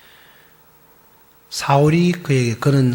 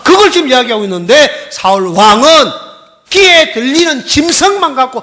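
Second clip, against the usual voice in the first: loud, high, driving sermon voice; full-force preaching; 10 semitones higher, 10 dB louder than usual. Acted. Pastor pressing the congregation, preaching at full force. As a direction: drive the point home to the congregation.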